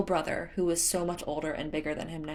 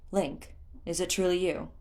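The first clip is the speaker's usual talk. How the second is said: usual speaking voice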